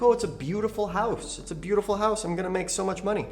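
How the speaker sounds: imitating the buyer lady. Investor imitating the auction buyer, lightly voiced naive delight. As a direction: lightly voice her clueless delight. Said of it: "And she's like".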